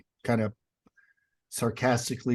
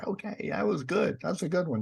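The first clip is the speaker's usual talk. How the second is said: higher, a light take on his son's easy approval; lightly voiced son; 7 semitones higher, 4 dB quieter than usual. A dad voices his son praising a story, lightly higher. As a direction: lift into the kid's voice, only lightly.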